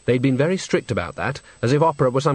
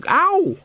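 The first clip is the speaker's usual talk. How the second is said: very high, sharp "ow"; acted pained yelp; 4 dB louder than usual. Nanny is elbowed mid-sentence, very high yelp. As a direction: squeal as if jabbed in the side.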